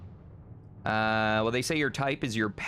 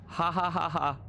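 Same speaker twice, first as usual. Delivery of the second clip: higher, evenly spaced "ha ha ha ha"; deliberately forced spoken laugh; fake laugh; 7 semitones higher than usual. Streamer answering chat's dating question, sarcastic fake laugh.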